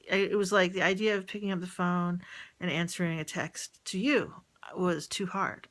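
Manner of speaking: normal talking voice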